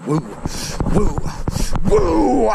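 high, loud whoops; repeated wooing, building to a high loud holler; woo call. Real, not acted. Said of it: "When I done the woo thing".

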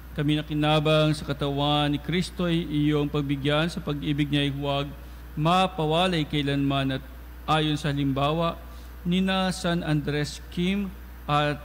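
ordinary talk, nothing marked

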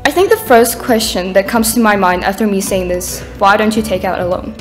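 ordinary talk, nothing marked

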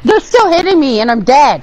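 high, loud exasperated shout; outraged yelling. Real, not acted. Gamer complaining enemies keep hitting the corpse, outraged shout. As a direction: shout it in real disbelief. Complaining about being hit after death.

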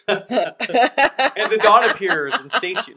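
laughing through the words, slow. Acted. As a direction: giggle it out slowly. GM mocks the dawn arriving.

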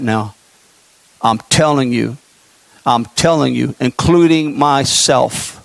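low, hushed, ominous preaching; solemn prophetic warning, held down low; grave sermon warning. Acted.

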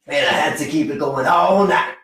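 put-on Southern American accent. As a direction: ham up an amateur Southern accent. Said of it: "what I thought passed for a Southern accent".

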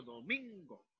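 "domingo" with a drawn-out MIN; stressed syllable. Shown as a demonstration. Teacher demonstrating the tonic syllable, "domingo" stressed do-MIN-go. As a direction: lean on the middle of "domingo": do-MIN-go. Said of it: "La sílaba tónica está constituida por min".

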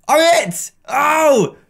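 high, delighted cry; thrilled gamer exclamation; excited. Real, not acted. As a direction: yelp with joy at being picked. Finally chosen as Seeker.

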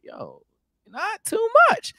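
put-on slow, unimpressed old head; unbothered old head voice. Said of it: "be like".

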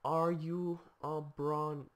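normal talking voice

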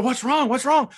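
high-pitched worried wife; imitating horrified wife. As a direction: ask in a put-on high voice of alarm. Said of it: "Julie is horrified".

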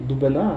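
«Дубина» з наголосом на останньому А; наголос у слові «Дубина». Shown as a demonstration. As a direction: stress the final syllable of «Дубина». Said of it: "Там зовсім інший наголос". («Дубина» з виразним останнім складом)

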